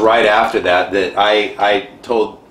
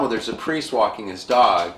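ordinary talk, nothing marked

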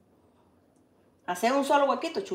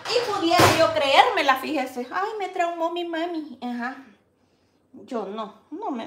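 ordinary talk

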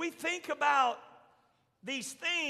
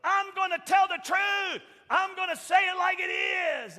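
loud, higher, bold shout; defiant declaration; 6 semitones higher, 4 dB louder than usual. Retold or imitated. John the Baptist walking off, shouting his defiance back at Jesus. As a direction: storm off proclaiming the truth.

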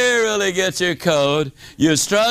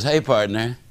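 low, easygoing buddy voice; golf buddy on phone; 7 semitones lower than usual. Retold or imitated. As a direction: invite him like a relaxed golf pal. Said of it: "Voice at the other end says".

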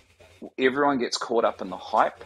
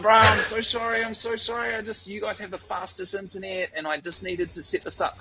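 sheepish miner pleading; 10 semitones higher than usual. Retold or imitated. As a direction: beg forgiveness like a caught kid. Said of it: "And he's like".

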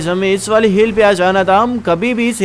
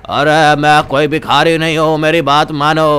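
put-on low-pitched old man pleading; low old-man character voice; old villager; 4 semitones lower than usual. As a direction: play an old villager insisting he be believed.

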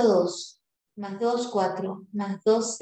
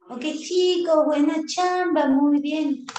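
high, bright praise for the class, a bit louder; cheerful praise; 6 semitones higher, 4 dB louder than usual. Real, not acted. Teacher praising the kids after the exercise, upbeat.

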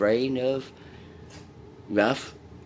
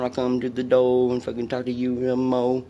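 normal talking voice

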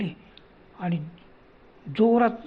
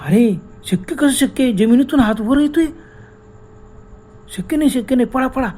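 higher, rising terror, breaking into run; panicked cry; 5 semitones higher than usual. Acted. Rohan sees a hand rising from earth, panicked. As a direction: shout them away in fear.

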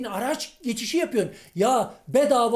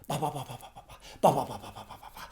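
low voiced baba repeated, fading to a whisper; mock calling daddy; 9 semitones lower, 8 dB quieter, much faster than usual. Vlogger mocking Bilal calling his father, fading to a whisper. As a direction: mimic a son crying out for his dad, dying away.

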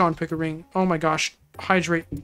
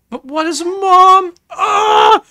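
a high, loud, slow cry of confusion ending in a scream; anguished cry; 10 semitones higher, 7 dB louder, much slower than usual. Acted. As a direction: cry out as memories break through. Confused character cries out in pain.

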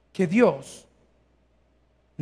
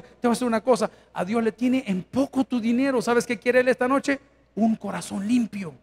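usual speaking voice

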